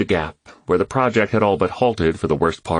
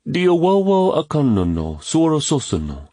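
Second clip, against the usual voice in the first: put-on Ghanaian proverb in a boy's higher voice; Ghanaian dialect; 9 semitones higher than usual. A narrator's reading. Kwame quoting a Ghanaian proverb, boy's voice. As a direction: recite it in the Ghanaian tongue. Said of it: "Kwame spoke it in the native dialect".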